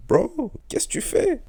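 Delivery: high, loud, stretched-out disbelief; calling out a lazy friend in shock; incredulous call-out. Retold or imitated. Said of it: "j'étais là en mode".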